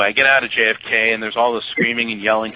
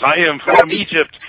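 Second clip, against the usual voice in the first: imitated accent of an Egyptian taxi driver; high, loud imitation of a foreign cabbie's accent; Hussein; 6 semitones higher, 5 dB louder than usual. Cabbie from Egypt answering his passenger, imitated accent. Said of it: "I guess I'm still doing Hussein".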